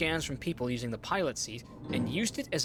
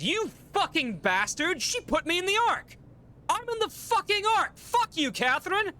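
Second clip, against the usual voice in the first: screaming curses, much higher and louder; swearing tirade; 5 dB louder than usual. Real, not acted. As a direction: yell the insults in total outrage. Outraged at being put in Ark.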